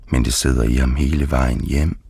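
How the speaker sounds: ordinary delivery, nothing marked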